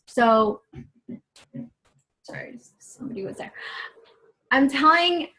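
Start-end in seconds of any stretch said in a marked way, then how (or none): 2.2-3.5 fast, hushed whisper; whispered aside. Real, not acted. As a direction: whisper an apology off to the side. Aside during recording.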